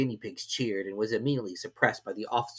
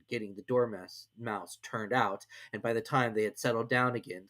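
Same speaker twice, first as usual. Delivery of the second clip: ordinary delivery, nothing marked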